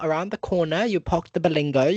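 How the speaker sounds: ordinary talk, nothing marked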